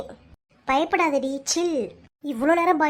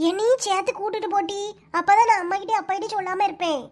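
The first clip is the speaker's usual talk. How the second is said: ordinary talk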